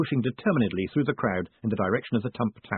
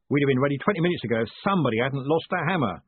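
plain reading voice

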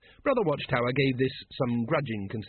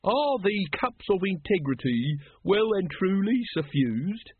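slow, higher-pitched ritual chant; intoned; 7 semitones higher, slower than usual. A narrator's reading. Brother Watchtower putting the ritual question, slowly intoned. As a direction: intone each word with ceremony. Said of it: "intoned Brother Watchtower".

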